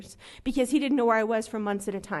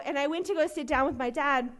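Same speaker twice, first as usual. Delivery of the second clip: ordinary delivery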